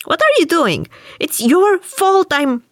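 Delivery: a seized ghost screeching in panic; shrieking spirit. Acted. Spirit grabbed by the collar, shrieking at Wilde.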